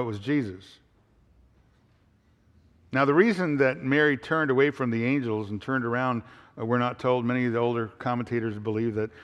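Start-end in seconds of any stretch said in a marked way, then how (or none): none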